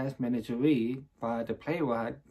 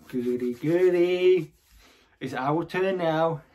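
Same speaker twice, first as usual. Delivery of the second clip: drawn-out, louder mock-gleeful cheer; higher, stretched-out stage excitement; acted glee; 3 semitones higher, 4 dB louder, slower than usual. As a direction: crow it slowly with theatrical delight. Acted glee within the monologue.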